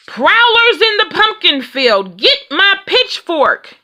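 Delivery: farmer's shout. A narrator's reading. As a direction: bellow it like an angry farmer catching thieves. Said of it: "they heard Ben shout".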